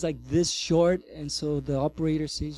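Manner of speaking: ordinary delivery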